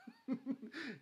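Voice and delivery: a sudden, high-pitched laugh; high burst of laughter. Real, not acted. Laughing at own remark.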